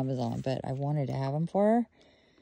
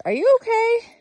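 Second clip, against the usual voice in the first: worried check on dog; 8 dB louder, faster than usual. Real, not acted.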